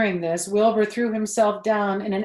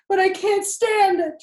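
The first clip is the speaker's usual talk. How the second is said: much higher and louder, desperate shout; 12 semitones higher, 8 dB louder than usual. A narrator's reading. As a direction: shout it out in despair. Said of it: "shouted Wilbur".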